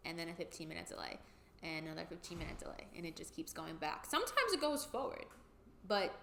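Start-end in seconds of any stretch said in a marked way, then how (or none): 0.1-4.1 dropping to a tired murmur, quicker; much softer resigned listing; weary murmur. Real, not acted. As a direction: trail off, defeated by the delays. Exasperated listing of endless delays.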